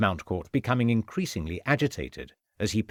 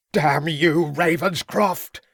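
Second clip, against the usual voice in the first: higher, louder furious yell; yelled curse; 6 semitones higher, 4 dB louder, slower than usual. A narrator's reading. Villain curses the inspector before charging, yelling. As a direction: hurl the curse with full fury. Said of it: "yelled Mountcourt".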